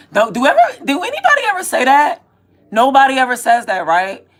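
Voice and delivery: loud, high, exasperated questioning; heated rhetorical challenge. Real, not acted. Ranting about what nobody ever says, loud and exasperated. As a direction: throw the question out at full volume.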